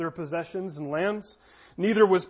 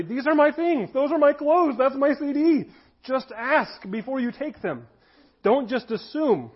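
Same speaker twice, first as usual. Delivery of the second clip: higher, pleading-annoyed complaint that slows at the end; upset protest; 6 semitones higher than usual. Retold or imitated. Younger brother upset at brother taking his things, indignant. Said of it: "I got upset".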